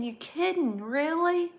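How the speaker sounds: high, loud amazed question; can't believe it; surprised. Real, not acted. Hearing the wife is from London.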